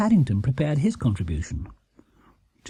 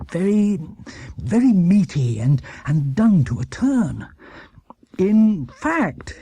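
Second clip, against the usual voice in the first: hearty praise; 5 semitones higher, slower than usual. Acted. Mr Brown praising dish.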